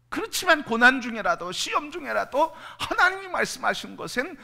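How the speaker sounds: high, loud preaching push; fervent preaching. Real, not acted. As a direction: keep the fire of the sermon going. Preaching at full force.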